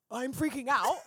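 incredulous rising question; mock-panicked question; retold freak-out. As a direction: act out disbelief at being told this. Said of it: "I was like".

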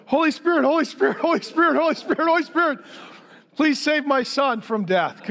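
hammed-up alarmed prayer, speeding up then slowing; panicked prayer. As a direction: mock-desperate plea to be saved. Panicked father praying in a rush.

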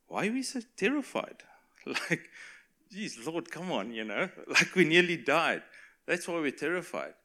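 high, exasperated comeback with a laugh; hammed-up disbelief, half laughing; mock incredulous protest. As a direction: protest as if the answer were obvious. Frightened disciples answering Jesus, acted out.

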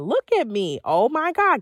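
high, loud, delighted gush; excited self-praise. Shown as a demonstration. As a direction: hype yourself up in the mirror. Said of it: "You gotta talk to yourself like that".

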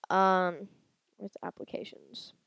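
ordinary delivery

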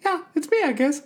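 very high squeaky cartoon mouse voice; Mickey Mouse voice. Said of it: "Walt Disney was the one who originally did the voice".

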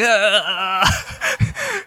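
high mock shriek of a toddler scared stiff; a mother mimicking her daughter's panicked scream; imitated toddler shriek. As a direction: squeal like a little girl frozen by a fly. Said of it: "She's like".

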